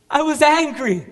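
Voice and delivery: high, angry cry building to loud; angry outburst. Acted. Soldier owning up to his anger, erupting loudly. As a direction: let the rage climb into a loud, high outburst.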